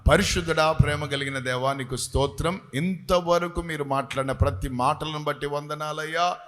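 earnest, steady prayer voice. Real, not acted. Pastor leads the congregation in an earnest prayer. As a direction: pray aloud for the whole church.

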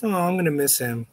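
usual speaking voice

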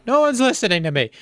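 high, loud complaint to nobody; frustrated outburst. Acted. Ignored son complaining to his parents, high and loud. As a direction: vent that nobody hears you.

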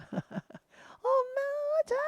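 put-on very high, slow child's call "엄마, 자!"; child calling mom. 회전목마 탄 아이가 엄마를 부르는 소리. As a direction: squeal like a happy kid at the park. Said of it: "이런 소리 들리겠죠".

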